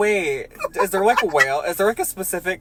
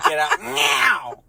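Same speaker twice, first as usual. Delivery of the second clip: mock angry dad; 12 semitones higher, 4 dB louder than usual. Dad threatening Timmy at the beach, high mock threat. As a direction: threaten Timmy like a furious father. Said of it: "the dad is like".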